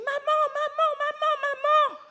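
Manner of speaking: put-on high, loud child's call for mum. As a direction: call mum like an excited little girl. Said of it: "Elle crie".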